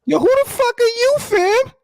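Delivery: mock confrontation. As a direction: play the tough guy confronting a stranger. Cohost mock-confronts the intruder.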